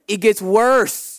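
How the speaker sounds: usual speaking voice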